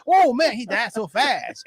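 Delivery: slow, breezy funeral chatter; mock cheery mourner. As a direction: keep the misplaced cheer. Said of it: "go to a funeral and go".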